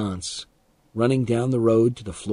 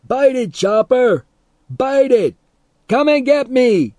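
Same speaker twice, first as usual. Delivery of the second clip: high, loud gleeful jeer; taunting yell; 13 semitones higher, 7 dB louder than usual. A narrator's reading. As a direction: goad the dog wildly. Teddy taunting the dog.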